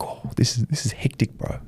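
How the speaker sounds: hushed, breathless whisper; whispered awe. Retold or imitated. Starstruck fan whispering on seeing Eddie Hearn. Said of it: "I'm like".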